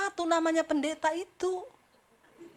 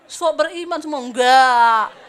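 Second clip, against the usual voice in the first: loud laughing jab; preacher mocking fake faith, laughing; laughing tease; 13 dB louder, slower than usual. Real, not acted. Teasing congregation, laughing.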